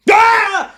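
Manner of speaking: high, very loud startling scream; sudden loud yell. Pretend. Testing a friend's fight, flight or freeze, sudden loud yell. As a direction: yell out suddenly to make them jump. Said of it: "That was really freaking loud".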